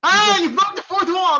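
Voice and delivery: high, loud delighted cry; gleeful callout. Real, not acted. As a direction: crow it loudly over everyone. Calling out the caller's slip.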